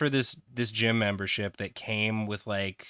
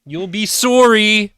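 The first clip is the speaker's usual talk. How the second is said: high, very loud mock threat; hammed-up vengeful shout, rising and held; body's threatening yell; 10 semitones higher, 13 dB louder than usual. The body threatening its owner with soreness, mock threatening yell. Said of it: "the body's like".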